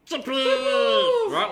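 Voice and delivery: squealing alien mascot yelling surprise; Gobble Doc surprise. Retold or imitated. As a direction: pop out like a cheeky alien mascot. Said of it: "like that".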